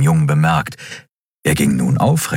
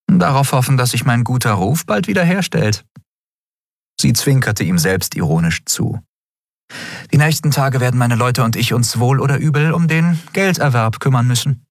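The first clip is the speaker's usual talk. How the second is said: lightly raised voice for the captain Endriel; a wry young captain, higher than the narration; Endriel voiced; 7 semitones higher than usual. Endriel's dialogue voice.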